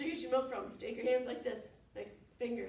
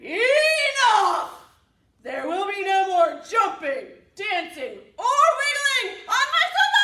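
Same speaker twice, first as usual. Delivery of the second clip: loud, high, raging yell; furious chicken shout; 10 semitones higher, 21 dB louder, slower than usual. Acted. Chicken shouting at the cows to stop.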